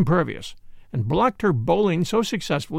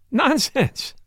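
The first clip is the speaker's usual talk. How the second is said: higher, exclaimed dismissal; cried out; 7 semitones higher than usual. Acted. Doctor scoffing at his sister's theory, crying out. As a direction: toss it off with a laughing cry. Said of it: "I cried".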